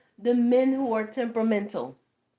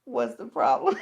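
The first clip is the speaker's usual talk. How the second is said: normal talking voice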